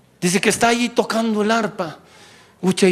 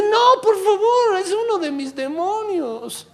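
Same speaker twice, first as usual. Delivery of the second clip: high, slow pleading devil; high whiny devil, pleading; 14 semitones higher, slower than usual. Pretend. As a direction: beg like a panicked demon. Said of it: "y el diablo dice".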